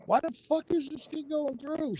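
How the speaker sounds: mock the plumber's baffled cursing at the clogged pipe; exasperated plumber. Plumber cursing the stuck drain, mock exasperation.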